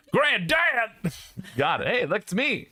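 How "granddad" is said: high shout of Granddad!; high granddad call. Acted. Streamer hypes the Nintendo game, high shouted call.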